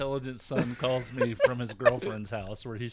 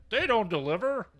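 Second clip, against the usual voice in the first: mock son; 11 semitones higher, 8 dB louder than usual. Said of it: "he's like".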